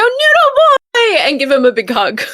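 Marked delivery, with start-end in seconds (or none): 0.0-1.2 high, loud joyful call; excited shout. Acted. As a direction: cry out his name in delight. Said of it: "she's gonna go".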